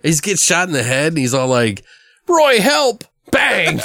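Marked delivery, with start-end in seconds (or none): 2.3-3.0 high mock cry for help; Brian Posehn. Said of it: "the way that Brian Posehn says it". 3.3-3.8 high, punched mock gunshot bang; spoken gunshot. Imitates the gunshot cutting him off.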